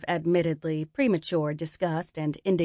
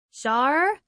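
high, raised call; 8 semitones higher, 4 dB louder than usual. Acted. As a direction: call out to a friend. Bursting in, calling a friend.

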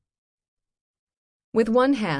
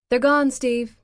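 curt; 8 semitones higher, 9 dB louder, slower than usual. Acted. Dana curtly refuses.

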